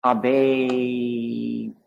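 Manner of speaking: Abe with the last vowel held long on a level pitch; drawn-out dominant vowel. Shown as a demonstration. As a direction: hold the end of Abe out steadily.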